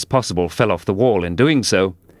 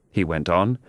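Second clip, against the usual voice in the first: usual speaking voice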